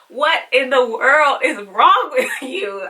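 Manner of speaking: exasperated laugh. Retold or imitated. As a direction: laugh through your disbelief, drawn out. Retelling outrage at doctor.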